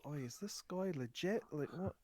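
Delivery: confiding gnome. Acted. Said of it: "he leans over to, to Poro and goes".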